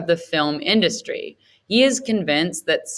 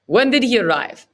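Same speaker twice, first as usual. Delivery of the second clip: gruff Setji voice; 7 semitones higher, 5 dB louder than usual. Acted. Setji questions Gupta.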